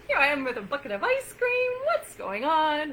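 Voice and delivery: high, laughing mock bewilderment at the fridge; laughing self-questioning. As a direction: giggle through it as if caught at the fridge at night. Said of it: "lean in and mindfully go".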